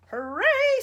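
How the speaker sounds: loud high gleeful cheer. A narrator's reading. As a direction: whoop with delight. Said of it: "said the chief of army".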